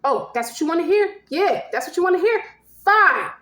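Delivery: high, goaded outburst; fed-up lover blurting it out; acted exasperated confession. Acted spiteful confession in quarrel.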